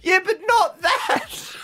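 high, drawn-out comeback through laughter; mock exasperation breaking into giggles; exasperated, laughing.

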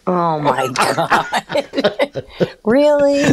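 laughing through it, drawn out slowly. Real, not acted. Cohost cracks up at Mark's joke, laughing. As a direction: let the laugh carry the words.

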